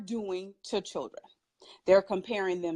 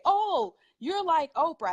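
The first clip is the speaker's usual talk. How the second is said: retold gushing remark, a little raised; admiring comparison; 6 semitones higher than usual. People telling the speaker who she resembles, lightly mimicked admiration.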